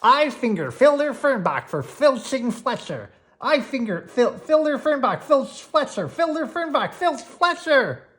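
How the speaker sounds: loud, high, fumbling the tongue twister; tongue twister shouted. A narrator's reading. Gwen racing up the hill, repeating the tongue twister loudly. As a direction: rattle it off, tripping up. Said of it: "Bet you can't say that again".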